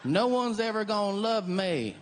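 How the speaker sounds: high, slow, pitiful Southern twang; sad Southern lady. Retold or imitated. Comic voicing a Southern lady putting herself down. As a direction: whimper it like a self-pitying country woman. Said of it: "some lady might go".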